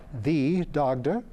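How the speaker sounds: "The Dagda?" drawn out slowly, rising high at the end; surprised question. Acted. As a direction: let the name land in disbelief. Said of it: "read widely in mythology".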